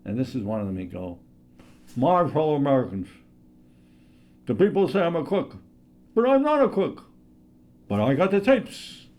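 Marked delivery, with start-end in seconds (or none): 2.0-9.1 Richard Nixon impression. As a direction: play the crook denial big, rising higher and louder. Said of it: "the first one I'd like to do is Richard Nixon".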